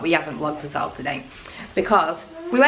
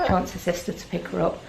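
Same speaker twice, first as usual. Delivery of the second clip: ordinary delivery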